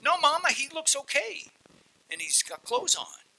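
put-on high, light child voice reassuring Mama; a little kid calmly telling Mom it's fine.

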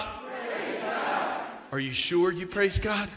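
breathy whisper then soft question; whispered praise. Retold or imitated. Minister prodding the kids, whispered. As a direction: whisper it, then probe softly. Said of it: "the youth minister would stop and say".

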